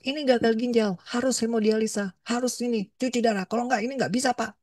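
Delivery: mock-voiced rushed doctor telling a patient; hurried doctor. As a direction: rattle off the diagnosis without tact. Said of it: "terburu-buru".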